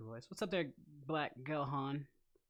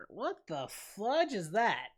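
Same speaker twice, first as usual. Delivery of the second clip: high, loud, slow disbelieving cry; incredulous exclaim; 7 semitones higher, 9 dB louder, slower than usual. Real, not acted. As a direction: blurt it out in disbelief. Reacting to absurd chat question.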